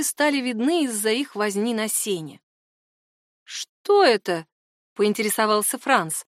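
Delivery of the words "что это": slow, drawn-out wary question; suspicious. A narrator's reading. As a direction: ask it slowly, with suspicion. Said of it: "В его голосе сквозило подозрение".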